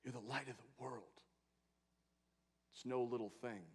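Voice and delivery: hushed Jesus voice. Acted.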